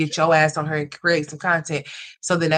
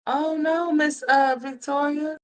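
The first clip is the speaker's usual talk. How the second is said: high, stricken "oh no"; dismayed gasp; 11 semitones higher than usual. Real, not acted. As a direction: pitch jumps up in shocked dismay. Hears sad news about viewer.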